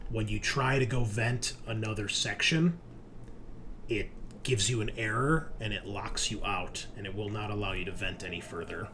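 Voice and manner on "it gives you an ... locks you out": ordinary talk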